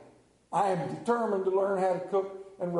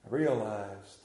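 solemn preaching; 8 semitones lower than usual. Acted. Slow solemn preaching turn.

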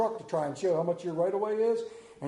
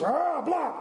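mock coach yell; 10 semitones higher, 4 dB louder than usual. Father reacts to baby's hit.

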